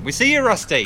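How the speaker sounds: loud playful call across the field. Real, not acted. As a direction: shout out to someone hiding. Calling out to hiding ghost.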